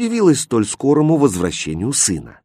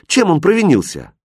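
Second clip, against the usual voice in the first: higher, indignant question; 7 semitones higher than usual. A narrator's reading. Aladdin defending the puppy from the boys, indignant. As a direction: demand a reason with mild outrage. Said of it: "возмутился Аладдин".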